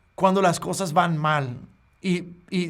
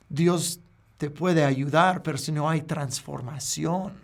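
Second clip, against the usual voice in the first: normal talking voice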